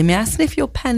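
put-on British accent. Co-host modelling a polite request, British accent. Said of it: "the most wonderful British accents".

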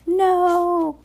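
high, loud drawn-out cry; desperate high-pitched "no" cry; horrified cry. A narrator's reading. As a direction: cry out to stop him. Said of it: "Violet cried".

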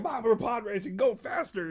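high, eager plea, mock-childish; excited kid urging. Kid urging mother to speed up the car, mock excited plea. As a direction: egg Mom on like an impatient kid.

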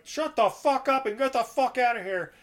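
put-on high, loud furious old man; old homeowner ordering thugs out, furious; angry homeowner. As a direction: yell them out of the house.

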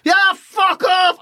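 slow, high yell; mock yelled abuse. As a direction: heckle from the stand. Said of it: "you give someone a bit of abuse".

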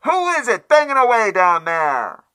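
high, loud, cross woman's voice; irritated maid calling from above; angry maidservant. A narrator's reading. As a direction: snap it down from the window, sleepy and annoyed. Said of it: "and angrily demanded".

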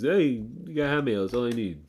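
high-pitched put-on guy voice; Joey Meatballs. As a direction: raise the pitch for a comic character. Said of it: "you're Joey fucking Meatballs".

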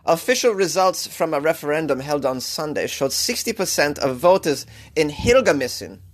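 news read in a hammed-up German accent; bad German accent. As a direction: amateurish German-accented newsreader. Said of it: "This is the worst German accent".

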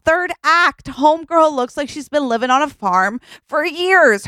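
high-pitched, impassioned explanation; emphatic argument. Acted. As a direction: prove your point with rising fervour. Acted heated explanation of film acts.

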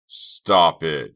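desperate yell. Acted. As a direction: shout at him to stop. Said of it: "Chuck yelled".

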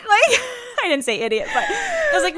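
high laughing voice through the words; cracking up mid-sentence. Real, not acted. Laughing while she tells her sister about the mover.

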